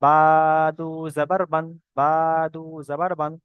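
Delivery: বা held for one long beat, then দু জাবার বান; one-alif stretch. Shown as a demonstration. Teacher demonstrating tanween spelling of বা, measured stretched recitation. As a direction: draw out the vowel of "বা" moderately. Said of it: "বা এক আলিফ পরিমাণ টান".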